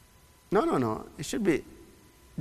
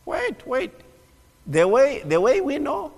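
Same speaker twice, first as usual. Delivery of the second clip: high, puzzled disciple voice; Thomas, high-pitched; 10 semitones higher than usual. Retold or imitated. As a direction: squeak out Thomas's confusion. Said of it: "And Thomas said".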